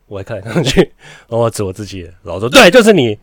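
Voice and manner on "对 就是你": high, loud sharp scolding; angry teacher yell. Retold or imitated. Teacher singling out the boy, loud and high. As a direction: snap at the kid who looks around.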